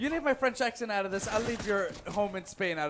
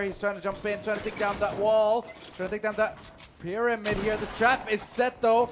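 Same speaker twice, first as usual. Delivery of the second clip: quick, rising caster call, louder as the fight heats up; excited play-by-play; 3 semitones higher, 5 dB louder than usual. Acted. Caster calling the fight for viewers, excited play-by-play. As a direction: call every move as it happens, building hype.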